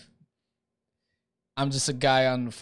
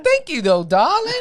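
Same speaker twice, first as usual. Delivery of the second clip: much higher, louder thank you darling; sweet high reply; 14 semitones higher, 7 dB louder than usual. Retold or imitated. His own reply to the cashier, high mock-sweet. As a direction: gush it in a falsetto. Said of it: "I'm like".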